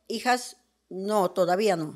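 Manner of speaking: God. Acted. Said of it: "O sea que Dios dijo".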